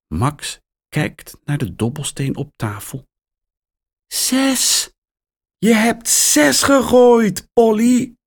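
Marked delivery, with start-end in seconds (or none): 4.1-8.1 much higher, thrilled cry; excited call. A narrator's reading. Mia cheering Polly for rolling a six, high excited call. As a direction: shout for joy at the parrot. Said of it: "roept Mia".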